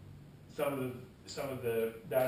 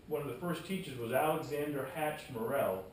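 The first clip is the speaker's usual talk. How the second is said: ordinary delivery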